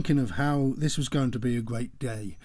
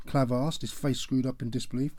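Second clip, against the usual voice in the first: ordinary delivery, nothing marked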